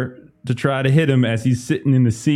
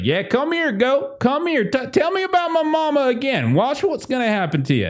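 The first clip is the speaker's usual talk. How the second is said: menacing yokel; 13 semitones higher than usual. Acted. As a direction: lure the goat in to club it. Farmer threatens goat.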